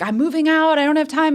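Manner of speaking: high, loud flustered protest. Retold or imitated. Student protesting to her theater director, high and loud. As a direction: brush off the invitation, rushed and flustered.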